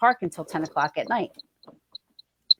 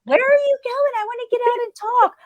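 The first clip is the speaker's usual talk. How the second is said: much higher, mom calling out eagerly. Retold or imitated. Mom calling after her fleeing child, high and eager. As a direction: voice your mom, way up high and keen.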